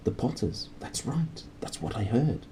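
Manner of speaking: hushed excited whisper. A narrator's reading. Strangers in cloaks whisper news about the Potters, hushed. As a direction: whisper it, passing on gossip. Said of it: "This lot were whispering excitedly".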